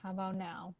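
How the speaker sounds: faint, distant audio; quiet through a weak mic; low mic volume. Real, not acted. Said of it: "you're still low".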